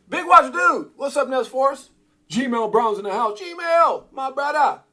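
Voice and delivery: high, loud greetings to friends; hyped shout-out. Real, not acted. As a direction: holler each name like a welcome across the room. Calls out greetings to arriving viewers.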